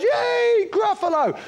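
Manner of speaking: put-on excited child cheer. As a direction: squeal it like a delighted little boy. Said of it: "And he goes".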